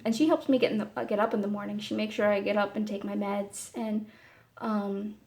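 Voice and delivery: usual speaking voice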